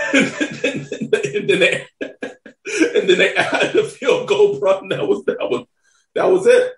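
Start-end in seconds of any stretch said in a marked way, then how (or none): 0.1-4.8 breathy laugh through the words; giggling while talking. Real, not acted. Laughs while recounting the score.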